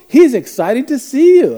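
high, loud, drawn-out mom voice; gushing mom. Retold or imitated. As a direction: imitate a mother loudly excusing her boy. Mother excuses the boy, voiced.

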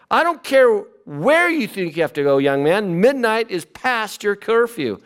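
high, stern mom voice scolding her son; scolding mother. Acted. A mother scolding her teenage son, high stern mom voice. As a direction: play the strict mother laying down curfew. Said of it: "How about Paul Revere's mother?".

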